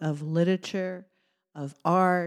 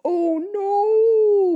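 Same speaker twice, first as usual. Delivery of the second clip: high squeaky cry of alarm; buzzing alarm; 14 semitones higher, 8 dB louder than usual. Acted. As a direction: shriek it like panicked bees. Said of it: "buzzed the bees".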